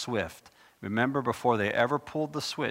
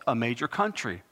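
ordinary talk

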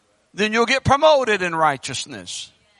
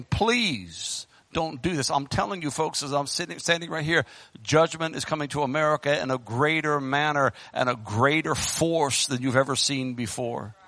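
low, hushed warning; grave, quiet preacher's plea; solemn warning; 8 semitones lower, 7 dB quieter than usual. Real, not acted. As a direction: drop down low and soft to warn them. Solemn warning of coming judgment.

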